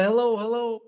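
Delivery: mock calling hello into a phone. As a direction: call out to a silent line.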